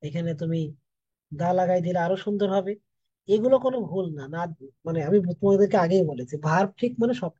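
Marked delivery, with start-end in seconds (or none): none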